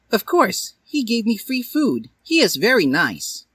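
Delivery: put-on high girlish happy voice; happy Dally. Dally happily praising Hiei, high and pleased. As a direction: chirp it up high.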